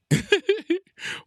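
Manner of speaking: high loud burst of laughter. Real, not acted. Gamer bursting out laughing at his own move, loud and high. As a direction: crack up loudly at the play.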